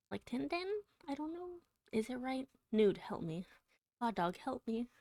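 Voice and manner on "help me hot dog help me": ordinary delivery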